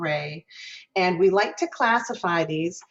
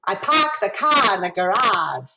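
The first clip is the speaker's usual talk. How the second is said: Boston accent, bright ah in park, car and garage, a bit louder; 5 dB louder than usual. Shown as a demonstration. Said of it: "If you're in Boston".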